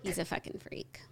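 hushed, voiceless aside agreeing with co-host; whispered echo. Real, not acted. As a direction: whisper it under your breath. Echoes co-host under breath.

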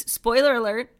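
ordinary talk

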